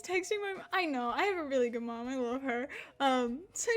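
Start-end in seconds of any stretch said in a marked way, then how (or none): none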